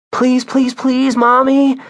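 very high, drawn-out pleading; begging child. Acted. Via begging mom alongside her brother, begging child. Children beg their mother.

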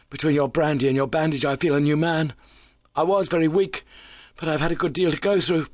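high, animated character voice; keyed-up injured visitor. A narrator's reading.

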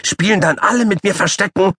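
high-pitched excited little animal; shrill repeated plea; squeaky child call. Acted. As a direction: chant it like a pestering puppy. Paule asks eagerly.

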